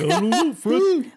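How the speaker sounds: loud, high, goofy sidekick voice; Barney Rubble. Retold or imitated. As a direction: do a dopey cartoon neighbour. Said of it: "he sounds like Barney Rubble".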